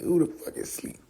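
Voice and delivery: breathy unvoiced whisper; soft whispered mockery; whispered repeat. Real, not acted. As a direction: mutter it under the breath.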